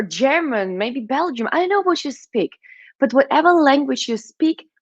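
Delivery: ordinary talk, nothing marked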